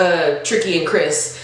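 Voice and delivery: ordinary talk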